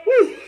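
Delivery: celebrating high "woo"; high whoop. Real, not acted. Preacher rejoicing over the little girl, high whoop. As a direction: whoop for the good news.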